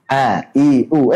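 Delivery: A, I, U said gently, hamzah flowing; soft hamzah. Shown as a demonstration. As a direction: glide into A, I, U without a hard catch. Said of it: "Ucapkan dengan lembut".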